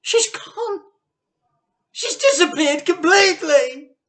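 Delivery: very high, loud distraught cry; wife crying out at the loss; shocked cry. Acted. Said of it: "said Missus Cranky".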